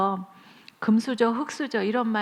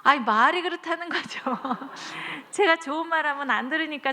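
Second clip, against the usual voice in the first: sheepish excuse; 5 semitones higher than usual. Retold or imitated. Excuse voiced again, laughing.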